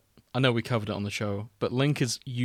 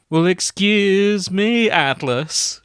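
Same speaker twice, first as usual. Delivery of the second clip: loud, higher, drawn-out catchphrase parody; hammed-up Brooklyn wisecrack; nineties Brooklyn man; 8 semitones higher, 8 dB louder, much slower than usual. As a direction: belt out an indignant, stretched "excuse me". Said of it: "he was a nineties Brooklyn man going".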